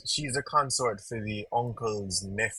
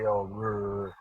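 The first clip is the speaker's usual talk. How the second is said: mock growling; 3 semitones lower than usual. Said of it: "get jealous and say".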